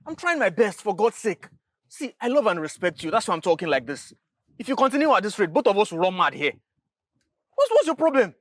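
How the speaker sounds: high, loud pleading anger; exasperated, near breaking point; frustrated shout. Acted. Brother begging Loretta to stop stressing him, frustrated shout. Sibling quarrel over broken car.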